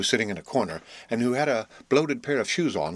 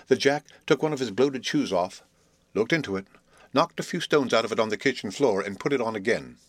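usual speaking voice

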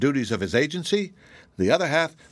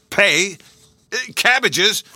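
shocked gasp, much higher and louder; 10 semitones higher, 7 dB louder, slower than usual. Acted. Morehouse hearing the cabbage bill, gasping in shock. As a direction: gasp the words out in disbelief. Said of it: "gasped Mister Morehouse".

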